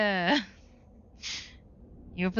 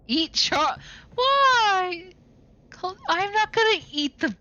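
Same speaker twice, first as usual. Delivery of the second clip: excited; 11 semitones higher, 4 dB louder, slower than usual. Pretend. Dinosaur player balks at eating friends.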